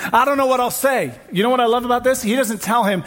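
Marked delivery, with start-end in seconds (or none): 0.1-1.1 mock worried excuse. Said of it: "we make it super complicated".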